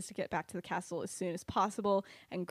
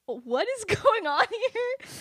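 high, loud, slow outburst broken by laughter; hammed-up freak-out, half laughing; mock panicked bewilderment; 13 semitones higher, 7 dB louder, slower than usual. Kyra reacting to news they are followed, hammed-up panic.

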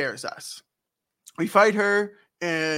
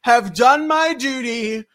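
high, loud, slow heroic declaration; noble knight; 8 semitones higher, 8 dB louder than usual. Retold or imitated. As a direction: proclaim it like a proud knight. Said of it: "And Steiner's like".

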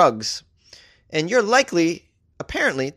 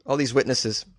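ordinary delivery

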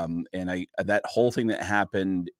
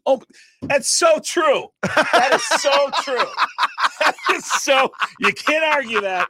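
high, slow, laughing dopey fan; dumb audience member; 13 semitones higher, 6 dB louder than usual. Retold or imitated. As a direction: gush like the dumbest guy in the crowd. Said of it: "I found myself several times in a Carrot Top show going".

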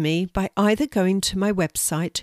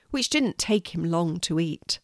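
plain reading voice

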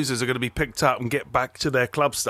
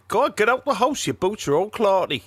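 higher, scolding Geordie voice; Newcastle accent; 6 semitones higher than usual. Acted. Telling someone with muddy boots to get out, in Geordie. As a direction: order them out in broad Geordie. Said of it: "Clarty means muddy boots in Newcastle".

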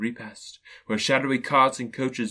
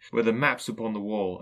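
plain reading voice

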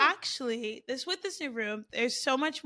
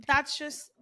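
normal talking voice

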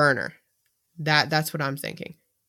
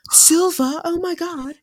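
high-pitched, incredulous fan impression; mock astonishment; 12 semitones higher, slower than usual. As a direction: gasp it out like a scandalized fan. Voicing shocked fans' reaction.

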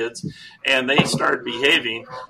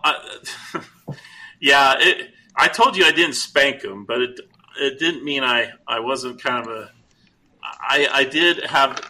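normal talking voice

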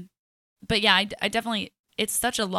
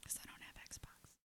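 whispering; 29 dB quieter than usual. Real, not acted. Whispered aside.

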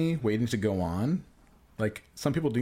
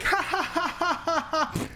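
obnoxious fake laugh. Heckler mocking bad comics with an obnoxious fake laugh. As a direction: laugh too hard on purpose. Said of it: "I was laughing really obnoxiously like that".

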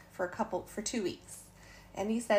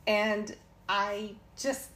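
ordinary talk

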